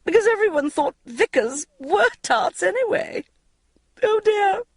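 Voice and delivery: high, giggly gossip laughing through the words. A narrator's reading. Older friend laughing at her own joke, high and giggly. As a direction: laugh it out like a tipsy older woman. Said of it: "she started to laugh".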